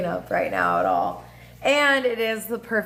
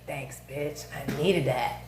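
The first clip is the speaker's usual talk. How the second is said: mock whisper; 6 semitones lower, 6 dB quieter than usual. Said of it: "be like".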